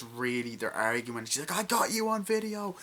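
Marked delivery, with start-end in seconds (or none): 1.5-2.8 high, loud imitation of a shout; mock triumphant yell. Retelling an argument behind the bar, high mock yell. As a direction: throw it out high like an accusation.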